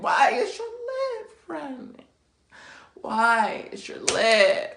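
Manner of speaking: very high, squeaky outburst; exasperated fuming. Retold or imitated. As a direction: squeal the question in hammed-up outrage. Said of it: "fuming because I'm like".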